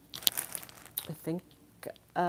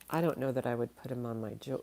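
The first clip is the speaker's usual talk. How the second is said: normal talking voice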